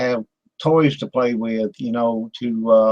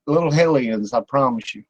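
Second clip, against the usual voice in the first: normal talking voice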